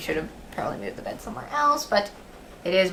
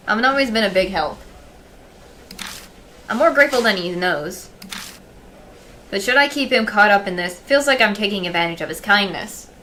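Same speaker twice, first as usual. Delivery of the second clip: louder, steady storytelling read; story narration; 6 dB louder than usual. Acted. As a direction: read it like a novel. Reading game narration aloud.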